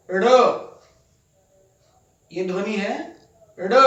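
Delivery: ड़ as a quick tongue flap, not a stop; ड़ tapped lightly off the palate; teacher showing the flap ड़; flapped ड़. Shown as a demonstration. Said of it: "अब ये ध्वनि है".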